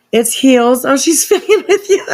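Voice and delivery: sudden loud excited cry; excited outburst. Real, not acted. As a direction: blurt it out, thrilled and loud, half laughing. Sudden excited reveal mid-reading.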